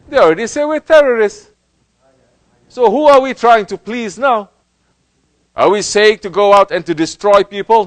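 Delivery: loud, high impassioned sermon; preaching at full force. Acted. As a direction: preach it out to the crowd. Preaching with raised voice.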